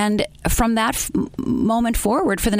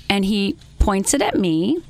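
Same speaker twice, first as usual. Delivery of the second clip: ordinary talk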